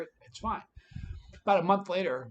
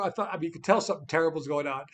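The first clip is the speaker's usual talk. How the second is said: ordinary talk, nothing marked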